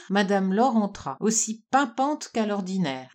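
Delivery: ordinary delivery, nothing marked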